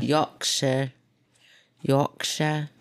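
"Yorkshire" as slow YORK-shuh, no r; British pronunciation. Shown as a demonstration. Practising for her friend's approval, "Yorkshire" as careful YORK-shuh. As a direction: round the first vowel and drop the r in "Yorkshire". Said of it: "that was it".

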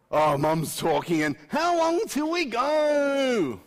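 loud, high, drawn-out whine; impatient teenager. Acted. Preacher imitating his teenager waiting on mum after church, impatient. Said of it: "This is not an impatient how long".

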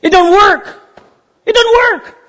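loud, high repeat; emphatic declaration. Acted. Preacher telling the congregation striving fails, loud. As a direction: hammer it home.

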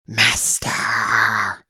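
a disgusting hissed whisper; hissing creature. A narrator's reading. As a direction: hiss it like a vile little creature. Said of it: "The creature hissed a disgusting".

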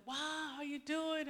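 high, drawn-out happy hello; delighted greeting. Retold or imitated. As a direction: greet a long-lost friend, stretching the wow. Greeting an old secretary on phone.

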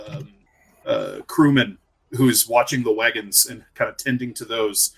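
ordinary talk, nothing marked

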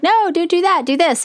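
high, eager no, don't; pushing the other player, urgently; insistent game advice. Retold or imitated.

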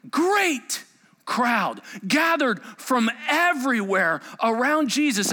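normal talking voice

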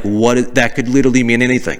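ordinary delivery

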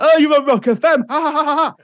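high, loud hammed-up radio prankster; gleeful put-on gotcha voice; prank reveal. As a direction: crow it out with a mock cackle.

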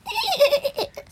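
high rabbit giggle; a quick squeaky giggle. A narrator's reading. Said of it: "The rabbits giggled".

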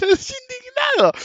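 laughing high and slow through the words. Real, not acted. Telling friends about the stolen bottles, laughing. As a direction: break into a laugh as you say it.

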